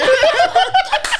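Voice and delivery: high-pitched burst of laughter. Real, not acted. Laughing after his crude joke.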